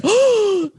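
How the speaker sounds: exaggerated gasped "oh", pitched high; hammed-up horrified reaction; mock shock. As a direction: imitate a scandalized gasp. Imitating a shocked listener's gasp.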